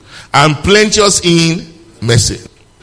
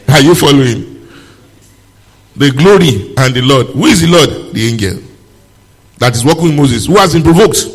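ordinary delivery

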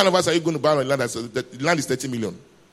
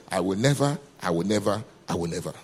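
"I will never" stepping lower and softer each time; triple emphasis; 7 semitones lower, 3 dB quieter than usual. Shown as a demonstration. Preacher quoting God's promise to the congregation, triple emphasis on "I will never". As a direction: repeat "I will never" as a sinking, weighty refrain. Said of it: "Triple emphasis".

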